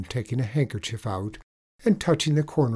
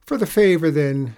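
lightly voiced leprechaun, raised pitch; leprechaun asking a favour; 6 semitones higher, 7 dB louder than usual. A narrator's reading. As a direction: put the request as the leprechaun, louder. Leprechaun asks his favour.

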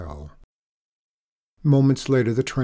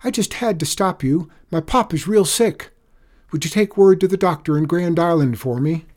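pleading; 7 semitones higher, 8 dB louder than usual. A narrator's reading. Frightened boy pleads with engineer.

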